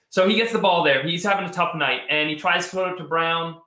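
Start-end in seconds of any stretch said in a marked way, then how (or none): none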